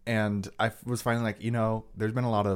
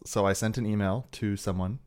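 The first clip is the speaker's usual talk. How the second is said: usual speaking voice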